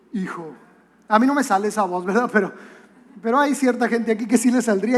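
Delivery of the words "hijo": low, soft attempt at God's voice; half-hearted movie-God impression; Hollywood God voice. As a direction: try a deep, fatherly God voice, not quite managing it. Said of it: "no te va a hablar así hollywoodescamente".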